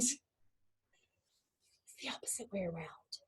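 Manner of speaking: slow, hushed whisper; whispered repeat. Real, not acted. Teacher repeating the key point to the student, whispering. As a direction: whisper it slowly to let it sink in.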